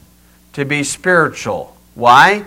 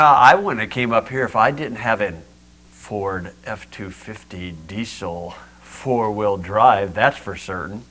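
low, soft mock boasting; understated smug brag in a lowered voice; 7 semitones lower, 6 dB quieter than usual. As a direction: play it self-satisfied, dropping low and quiet. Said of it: "sit here smugly and say".

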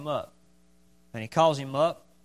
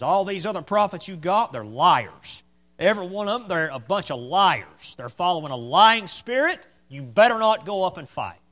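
lightly acted accusing tirade, building louder; mild denunciation; 3 semitones higher, 7 dB louder than usual. Micaiah warning the king about lying prophets, mildly denouncing. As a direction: denounce them like a preacher, pressing harder toward the end.

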